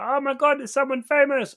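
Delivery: high, loud mock squeal of drunk clubbers; over-the-top shrieky fan impression. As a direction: imitate a starstruck drunk crowd shrieking. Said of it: "all the drunk people were like".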